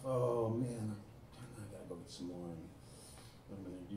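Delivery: low, soft worried inner voice; thinking to myself. Retold or imitated. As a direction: murmur it as a private thought. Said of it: "And I'm thinking to myself".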